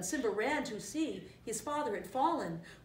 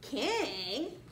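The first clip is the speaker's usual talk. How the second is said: higher, rising incredulous question; surprised cry; 4 semitones higher than usual. A narrator's reading. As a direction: echo the word in cartoon surprise. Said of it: "cried Timon and Pumbaa in surprise".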